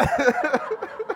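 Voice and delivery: laughter climbing up high; high rising laugh. Real, not acted. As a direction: let out another high laugh. Laughs again after the story.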